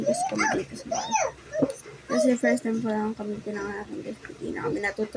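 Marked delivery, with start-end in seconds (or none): 0.0-1.1 high-pitched, fast silly voice. Pretend. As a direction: squeak it out quickly as a joke. Joking claim.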